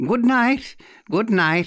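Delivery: high, theatrical farewell; cried out. A narrator's reading. Aunt dismissing her guest, crying out high. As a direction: sing out the goodbye, falling on the repeat. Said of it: "she cried".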